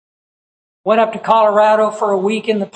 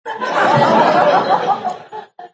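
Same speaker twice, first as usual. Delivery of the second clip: high burst of genuine laughter; laughing at his own foolishness, real laugh; 12 semitones higher than usual. Real, not acted. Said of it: "And I started laughing".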